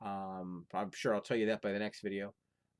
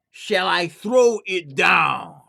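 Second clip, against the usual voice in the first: slow, drawn-out, loud ghostly shout; the spirit bellows down the chimney; 7 semitones higher, 16 dB louder, much slower than usual. Acted.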